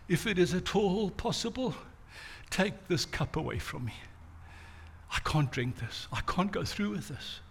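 whisper-close begging to be spared; Jesus on the ground begging the Father softly; anguished prayer. Retold or imitated. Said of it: "he says".

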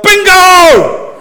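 loud, high-pitched shout of victory; yelling out. Shown as a demonstration. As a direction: yell it out like a bingo winner. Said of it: "you yell out".

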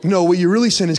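ordinary delivery

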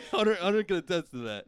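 laughing through the words. Real, not acted. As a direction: chuckle while saying it. Laughing while telling.

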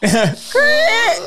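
creak noise. Retold or imitated. Imitating door creak again.

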